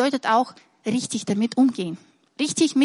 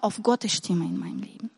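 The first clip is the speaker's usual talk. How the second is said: whisper; 3 semitones lower, 8 dB quieter than usual. Real, not acted. Closing resolve, whispered.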